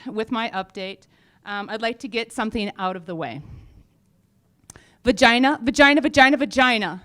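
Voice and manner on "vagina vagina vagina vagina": loud repeated word. Shown as a demonstration. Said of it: "See, that works".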